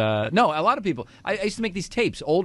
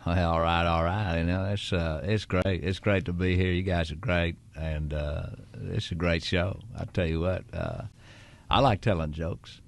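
Matthew McConaughey voice; 4 semitones lower than usual. Shown as a demonstration. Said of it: "So in the Matthew McConaughey voice".

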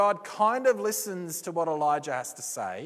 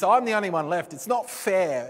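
a hammed-up whinge, higher at first; whinging Elijah; 5 semitones higher than usual. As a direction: whine like a sulking child about unfairness. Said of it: "Elijah's whinge is".

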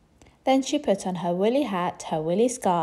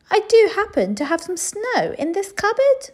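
cook voice; 12 semitones higher, 7 dB louder than usual. Acted. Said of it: "said the cook".

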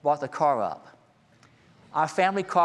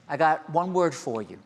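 ordinary talk, nothing marked

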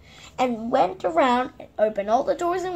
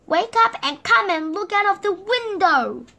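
higher and louder, eager; excited urging; 8 semitones higher, 7 dB louder than usual. Acted. Mister Silly urging his friend to the window, loud and eager. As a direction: hurry him to the window.

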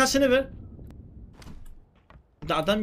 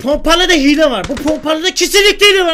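loud furious complaint; angry rant; 4 semitones higher, 7 dB louder than usual. Real, not acted. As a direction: rage at the game, loud. Raging about cheating shotgun.

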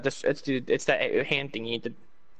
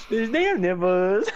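mocking repeat; 6 semitones higher, 4 dB louder, slower than usual. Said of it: "laughs, repeats phrase".

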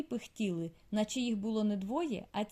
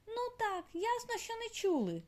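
grumbling; 12 semitones higher than usual. A narrator's reading. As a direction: mutter it like an annoyed little girl. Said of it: "буркнула мала".

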